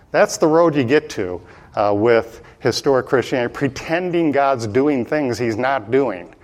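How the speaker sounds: ordinary delivery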